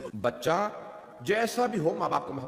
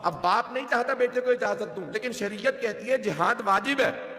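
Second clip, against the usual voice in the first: impassioned preaching; 6 semitones higher than usual. Acted. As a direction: preach it out to the gathering with rising fervour. Rising Muharram majlis sermon.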